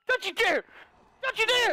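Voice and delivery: high, frantic warning yell; angry warning shout. Pretend. Player warning Ava mid-chase, angry high shout. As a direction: shout them off, pitch jumping up. Threatening fleeing opponent.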